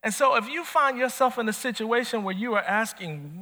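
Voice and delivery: normal talking voice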